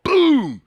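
high, loud kick sound; big sound effect boom. Retold or imitated. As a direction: punch out the impact. Kicking the ball.

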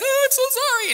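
very high, gushing mock apology; squeaky hammed-up apology. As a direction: ham up an over-sorry guilty voice.

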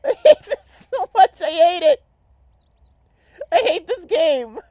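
high, loud laughing rant. Real, not acted. Ranting at awful game.

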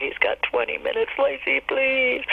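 high, slow drawn-out please; desperate plea. Retold or imitated. Friend begging for the dog, high slow plea.

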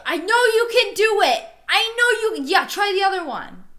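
high, loud cheering-on; excited urging. Real, not acted. Cheering a stranger's tongue attempt, excited urging. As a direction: egg them on at the top of your range.

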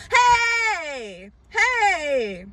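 high, loud battle cry; ringing war cry; army shout. Acted. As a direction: shout it like a whole army charging. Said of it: "have the whole army give a loud shout".

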